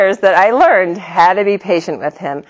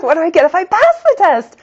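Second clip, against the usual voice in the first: higher, wide-eyed question; mock naive asking; 9 semitones higher than usual. Mother asking her rebbitzin what passing the test brings. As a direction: ask it like a puzzled student.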